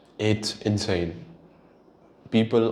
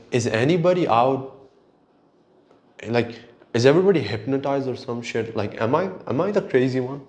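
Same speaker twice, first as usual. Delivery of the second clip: ordinary delivery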